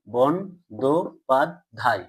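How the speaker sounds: "বন, দো, পাদ, ধাই" with a gap after each; syllable by syllable. Shown as a demonstration. Teacher checking with pupils, "বন, দো, পাদ, ধাই" in separate syllables. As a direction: sound out "বন, দো, পাদ, ধাই" beat by beat. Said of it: "উচ্চারণ করো স্বল্পতম প্রয়াসে".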